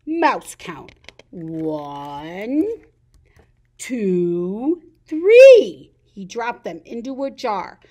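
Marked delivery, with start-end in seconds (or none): 0.0-5.9 a hint of a sly snake counting; slow sly count, last number loud. Snake counting the sleeping mice, sly with a loud three. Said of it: "said the snake".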